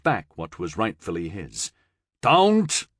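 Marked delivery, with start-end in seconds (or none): 2.2-2.9 high, loud, urgent warning; loud, high cry of alarm; sharp warning. Acted. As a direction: stop him dead with one word. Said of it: "Lucan warned".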